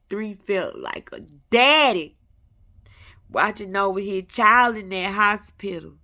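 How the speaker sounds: fervent testimony. Real, not acted. Emotional testimony peak.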